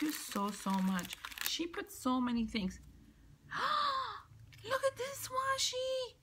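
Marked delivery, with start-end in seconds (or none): 3.5-4.2 sharp high gasp of delight. Real, not acted. Spotting cat washi tape, delighted high gasp. 4.6-6.2 much higher, squealing delight; high-pitched squeal. Real, not acted. Gushing to viewers over cat washi, high squeal.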